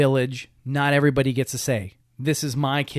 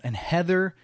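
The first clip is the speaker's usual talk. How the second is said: ordinary talk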